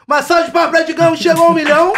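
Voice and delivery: loud, high hype-man cheer; comemoração animada. Real, not acted. Host calls for applause for a milestone, celebratory shout. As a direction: announce it like a rousing call for applause. Apresentador pede palmas e comemora.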